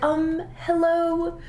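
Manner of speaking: high, drawn-out, hesitant hello; mock tentative greeting. Tourist checking if strangers speak English, mock tentative greeting. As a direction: test the water timidly, high and slow.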